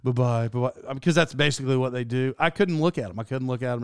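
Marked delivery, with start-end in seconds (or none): 0.0-0.9 low, dismissive repeated farewell; mock cabin-crew send-off, tailing down; Saturday Night Live flight attendant. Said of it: "the Saturday Night Live thing".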